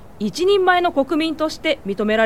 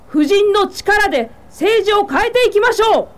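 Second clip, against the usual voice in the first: louder, higher speech to a crowd; rousing public speech; 5 semitones higher, 4 dB louder than usual. Acted. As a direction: address the whole hall and rally the women. Speech to assembled women. (婦人たちに向けた力強い演説)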